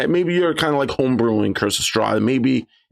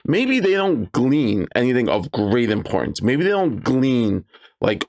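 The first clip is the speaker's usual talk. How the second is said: ordinary delivery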